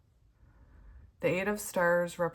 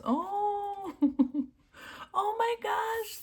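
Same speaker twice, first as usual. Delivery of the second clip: very high, slow exclaim; stunned cry; 3 dB louder, much slower than usual. Real, not acted. Reader reacting to a sad card. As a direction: gasp it out, overwhelmed.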